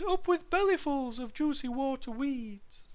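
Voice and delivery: high duckbill dinosaur hoot; happy piping cheer. A narrator's reading. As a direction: hoot it out with delight, far up in pitch. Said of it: "they hooted".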